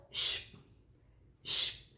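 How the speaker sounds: soft shush for the silent beat; shushing rest. Shown as a demonstration. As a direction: hush it out like a rest. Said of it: "No começo, a pausa".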